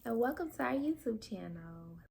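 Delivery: put-on high, soft voice for the baby; lightly voiced baby talk; baby's voice. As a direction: coax the baby to greet the viewers.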